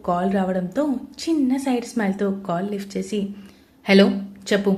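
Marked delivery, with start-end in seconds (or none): 3.9-4.7 bass voice. Acted. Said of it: "అన్నాడు base వాయిస్తో".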